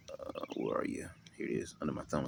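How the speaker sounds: hushed, half-whispered aside; muttering to oneself. Real, not acted. Preacher hunting for his page in the book, muttering to himself. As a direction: murmur it under your breath while searching.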